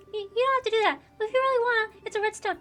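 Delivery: put-on a kid who suddenly wants help after all; little girl. Girl describing her lost red stone, high and eager.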